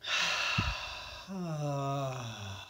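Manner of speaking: long, low exhaled moan; moaning sigh of pleasure. Shown as a demonstration. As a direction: let out a slow sigh of pleasure. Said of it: "a moaning of pleasure".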